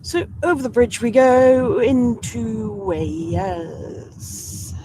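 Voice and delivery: a deliberately bad Welsh accent, drawn out slowly; awful Welsh accent. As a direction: ham up a bad Welsh accent crossing into Wales. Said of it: "Apologies for my awful Welsh accent".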